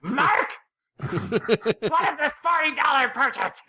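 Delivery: high, laughing shrill-free wife yell; mock angry wife. A wife yelling at Mark over a forty dollar charge, mock scolding. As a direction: play a wife scolding her husband over a bill. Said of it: "get screamed at with their wife".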